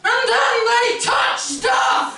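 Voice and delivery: slow, high exasperated shout. Real, not acted. Comic exasperated at visitors touching art. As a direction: bellow it in disbelief.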